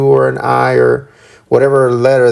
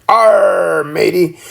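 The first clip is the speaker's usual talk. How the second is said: pirate voice; 6 semitones higher, much slower than usual. Retold or imitated. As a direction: ham up an old sea pirate. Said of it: "like a pirate".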